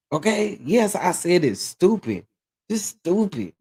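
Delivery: ordinary talk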